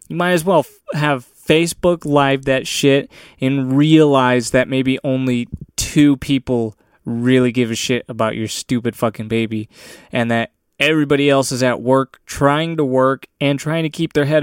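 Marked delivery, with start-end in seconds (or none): none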